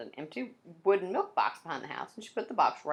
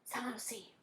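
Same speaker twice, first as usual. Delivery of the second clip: urgent unvoiced whisper; hushed kid's warning, breathy; 7 semitones higher, 8 dB quieter than usual. A narrator's reading. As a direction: hiss a warning so no one hears. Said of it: "Eric whispered".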